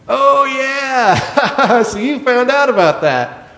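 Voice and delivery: high, loud, laughing recognition; amused staff. Retold or imitated. Rounds team to the chaplain who found out, laughing. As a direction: imitate colleagues cracking up, in on the joke.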